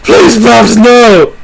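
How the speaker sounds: hammed-up begging for mercy; pleading victim. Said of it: "He's just all like".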